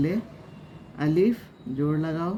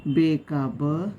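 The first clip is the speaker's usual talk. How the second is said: slow spelled demo; slower than usual. Shown as a demonstration. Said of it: "कैसे पढ़ेंगे उसको?".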